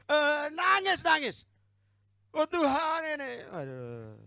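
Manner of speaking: loud, high hammed-up wailing dropping to a groan; exaggerated fake sobbing lament; mock crying. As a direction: wail like a pretend crybaby, then sag. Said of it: "Nangis di kamar".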